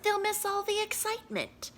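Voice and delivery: high fussy principal; lightly voiced fussy principal. Principal's voice in story.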